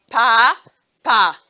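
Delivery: "papa" as two separated beats, pa, pa; loud, rising first pa, pause, falling second pa; syllable by syllable. Shown as a demonstration. Teacher showing two syllables, "papa" split in two. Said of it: "Le mot papa a deux syllabes".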